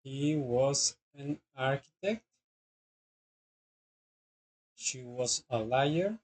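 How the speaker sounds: slow, careful classroom reading of each English sentence; teacher dictating example sentences slowly; slow model sentences. Shown as a demonstration. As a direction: read it out slowly for students to copy.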